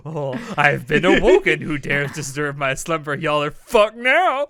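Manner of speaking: loud booming awakened giant; sleeping ancient god. Acted. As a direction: roar it like an ancient being woken up. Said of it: "He's peeking through like".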